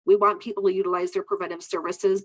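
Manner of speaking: ordinary talk, nothing marked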